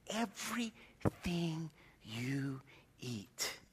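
slow, spaced-out whisper; dramatic whisper. Retold or imitated. Nutrition coach tells him to log food, slow dramatic whisper. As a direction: whisper it word by word, like a dread sentence.

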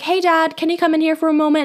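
light, high, quick call to Dad. Retold or imitated. Daughter asking Dad to come sign a form, high quick call. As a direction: pitch it up sweetly, as if calling a parent.

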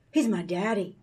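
normal talking voice

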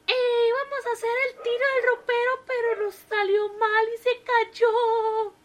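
high, loud, hesitant explanation; the wife fumbles an excuse to the paramedic; flustered wife. A narrator's reading.

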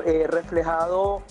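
ordinary talk

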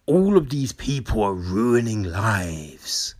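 mock-solemn, hushed and heavy delivery; cringy speechmaker. Mocking someone speechifying at an awards show, hammed-up solemnity. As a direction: overdo the grave, whispery indignation. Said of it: "someone gets up there and they're just like".

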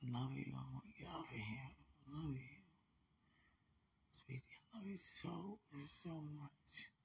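drowsy soft whispers; fading into sleep mid-sentence; nodding off. Real, not acted. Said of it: "I'm dozing off".

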